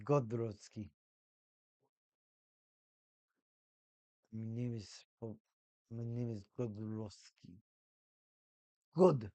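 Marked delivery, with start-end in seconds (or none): 0.0-7.6 low, slow put-on Russian accent for Godrovsky; mock Russian accent. Fan voicing the goalie as Godrovsky, low mock Russian accent. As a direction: announce the name like a deadpan Russian villain. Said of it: "Best Russian accent right there".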